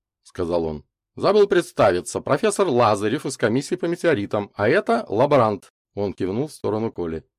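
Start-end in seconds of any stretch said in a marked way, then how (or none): 1.2-5.7 raised, louder introduction; brisk professor. A narrator's reading. Лазарев introduces himself to the group, brisk professor. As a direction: present yourself and the assistant formally.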